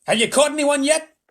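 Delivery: high, loud, cold rebuke; icy. A narrator's reading. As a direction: freeze him with your tone. Miss Tibbs interrogates spy.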